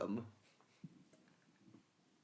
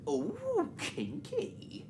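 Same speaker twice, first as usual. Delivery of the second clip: high squeaky ooh kinky; much slower than usual. Pretend. Saucy joke repeated.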